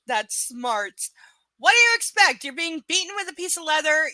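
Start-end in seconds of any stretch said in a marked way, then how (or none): 1.6-4.1 loud, high-pitched outburst; yelling incredulously at the book's heroine; exasperated rant. Real, not acted. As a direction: shout it in disbelief at the character. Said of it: "ranting outburst".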